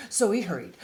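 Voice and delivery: lively picture-book read-aloud; reading to children. A narrator's reading. Reading a bear picture book to young listeners, animated storytime voice. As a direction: read it like storytime for little kids.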